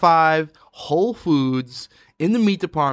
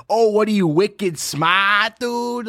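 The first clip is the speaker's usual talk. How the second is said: hammed-up Boston accent, higher; mock Boston accent; 6 semitones higher, much slower than usual. Teasing a Bostonian with a higher mock Boston accent. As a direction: poke fun at a thick Boston accent. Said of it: "then immediately going".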